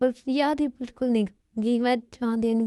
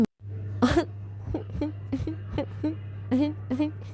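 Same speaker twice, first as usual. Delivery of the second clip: sob; 4 semitones higher, 5 dB quieter than usual. Acted. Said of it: "रोना बंद करो".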